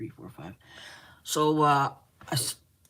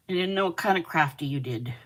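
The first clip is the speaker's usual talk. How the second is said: ordinary talk, nothing marked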